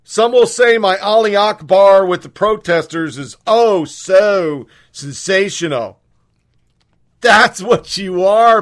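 loud, high furious tirade; angry shouting. Real, not acted. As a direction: shout at them in outrage. Shouting at protesters.

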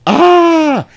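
high, loud burning shriek; hammed-up yell of agony; high loud vampire scream; mock scream of pain. Vampire's hand catches fire.

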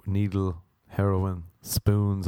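normal talking voice

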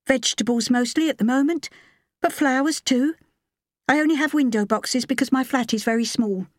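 high, steady woman's character voice; woman applicant. A narrator's reading.

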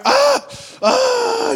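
high-pitched imitated labor cries; mock crying wail. Preacher imitating a mom in childbirth, mock crying wail. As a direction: cry out like a mom in labor, hammed up. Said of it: "she's crying".

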